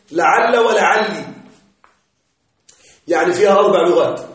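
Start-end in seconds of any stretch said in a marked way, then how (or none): 0.1-1.2 لعل with fatha, then لعلي with kasra, slow; final vowel contrast. Shown as a demonstration. Teacher drilling students on the endings of لعل, slow and clear. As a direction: stretch لعل and لعلي to show the two endings. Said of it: "يوجد الفتح والكسر".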